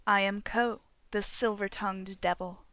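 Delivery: plain reading voice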